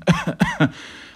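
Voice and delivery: put-on loud old man's cough; acted cough. A narrator's reading. Grandfather after laughing, loud acted coughing fit.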